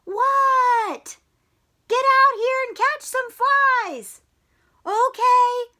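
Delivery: high, loud shouting; shouted exchange. Acted. Coach and Froggy shouting across the field.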